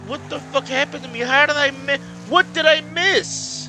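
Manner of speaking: high, loud, bewildered outrage; furious yelling. Real, not acted. Angry racer baffled by the game, furious yelling. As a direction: shout it, stretching the end. Furious at losing place in race.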